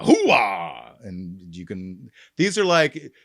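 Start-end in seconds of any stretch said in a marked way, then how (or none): 0.0-1.0 low barking catchphrase; Al Pacino. Retold or imitated. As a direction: bark it like a worn-out colonel. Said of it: "Al Pacino".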